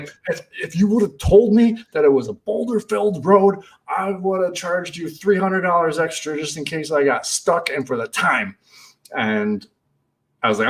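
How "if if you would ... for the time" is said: retold angry yell. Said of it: "I was screaming at him".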